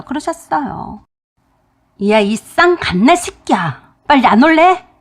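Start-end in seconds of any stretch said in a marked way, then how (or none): 2.1-4.9 roaring father. Retold or imitated. Father roaring at his son to come now, furious and loud. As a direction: thunder at the son. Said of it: "큰 소리로".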